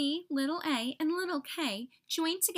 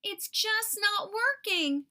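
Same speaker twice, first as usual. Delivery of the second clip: higher, drawn-out wail of frustration; slow, high-pitched outburst; frustrated cry out; 8 semitones higher, 4 dB louder than usual. A narrator's reading. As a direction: cry out in storybook exasperation. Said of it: "little t cried out".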